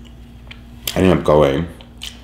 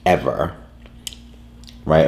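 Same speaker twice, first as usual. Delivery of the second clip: ordinary talk, nothing marked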